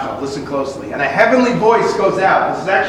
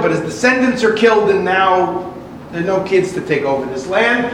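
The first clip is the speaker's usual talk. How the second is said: normal talking voice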